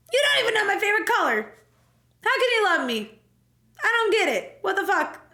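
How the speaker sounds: high, exasperated protest; mock indignant. Player objecting to Steve's confession, high mock indignation. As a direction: protest in baffled disbelief.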